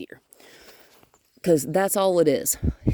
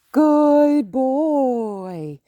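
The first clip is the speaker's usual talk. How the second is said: praise voice; 6 semitones higher, 5 dB louder than usual. Real, not acted. Drawn-out praise to dog.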